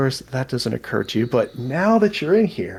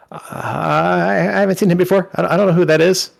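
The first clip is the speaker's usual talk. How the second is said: a touch higher, hesitant goblin voice; flustered goblin; 5 semitones higher, 4 dB louder than usual. Acted. Goblin puzzling over who the stranger is, flustered. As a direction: stumble through it, unsure and nervous.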